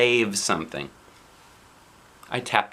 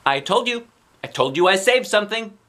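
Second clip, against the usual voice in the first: proud; 9 semitones higher, 3 dB louder than usual. Acted. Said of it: "very proud".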